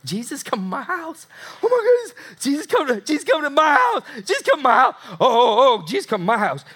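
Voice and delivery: overjoyed tax collector who can't believe it; excited Zacchaeus. Acted. Zacchaeus shouting with joy that Jesus is coming to his house. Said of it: "in great excitement and joy".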